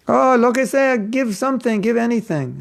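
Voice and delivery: high, pleading beggar's whine; mock begging. Book distributor begging any amount from a stingy donor, high mock whine. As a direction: squeak it out like you'd take anything at all.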